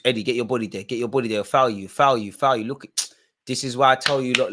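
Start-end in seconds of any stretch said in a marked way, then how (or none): none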